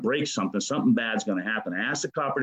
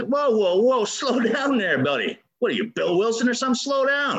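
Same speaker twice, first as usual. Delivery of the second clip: brushing off the sponsor with swagger; high-pitched retold pushback; mock protest; 12 semitones higher, 4 dB louder than usual. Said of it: "And I said".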